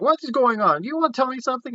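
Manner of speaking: high-pitched put-on girl's voice; imitated suspicious questioning. Retold girl asking friend what is going on, high suspicion. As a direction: probe for answers in high mock suspicion.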